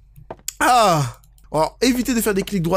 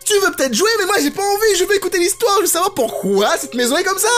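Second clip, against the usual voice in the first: high-pitched exasperated scolding; indignant scolding; 9 semitones higher than usual. Pretend. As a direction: scold her like an offended prude. Scolding game character Emily. (indignation outrée)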